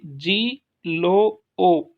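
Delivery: जी, लो, ओ spelled out slowly one by one; slow, loud syllable count; counting syllables back. Shown as a demonstration. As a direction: tick off each syllable from the end. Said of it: "पीछे से तीसरे".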